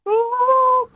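a high, joyful "Oh" cried out; winning punter's delighted cry; excited cry. Real, not acted. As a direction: let out a thrilled yelp of glee. Caller thrilled about Peru win.

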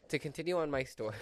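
ordinary talk

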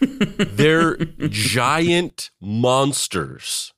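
drawn out with a laugh; real laugh while calling them monsters. Real, not acted. Laughing at emus being scary.

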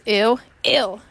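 loud, mock-disgusted ew from the comedy skit; skit voice impression. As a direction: toss off the skit's ew like a TV bit. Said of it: "that old skit that he does".

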